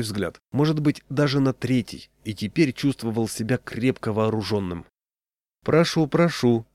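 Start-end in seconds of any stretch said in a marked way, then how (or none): 5.6-6.7 inviting. A narrator's reading. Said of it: "пригласил он меня".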